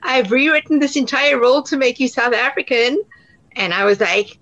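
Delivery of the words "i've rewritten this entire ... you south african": lightly voiced eager director, higher pitch; higher-pitched, as a director breaking news; retold director's pitch. Director tells actress about the rewrite, lightly voiced retelling. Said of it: "came back to me a couple of months later to say".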